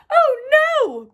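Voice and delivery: high, loud alarmed cry; buzzing alarm. A narrator's reading. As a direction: squeal it like a panicked bee. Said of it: "buzzed the bees".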